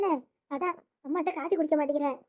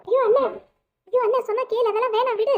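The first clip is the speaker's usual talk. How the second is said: alarmed pleading; 5 semitones higher than usual. Acted. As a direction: beg him urgently to stop. Sister stops brother's threatened attack. (பதறிக் கெஞ்சும் குரல்)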